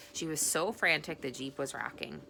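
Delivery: plain reading voice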